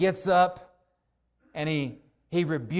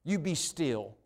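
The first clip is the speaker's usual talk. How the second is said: calm command; 3 semitones lower, 6 dB quieter, slower than usual. Retold or imitated. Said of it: "Can tell the wind and the waves".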